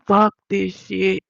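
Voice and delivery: slow, loud, exasperated; mock fed-up shout. Voicing the defeat the caseworker wanted, a slow loud shout. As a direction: throw it away like quitting for good. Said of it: "she wanted me to say".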